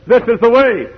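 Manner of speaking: high, loud voice from behind; insistent call. Acted.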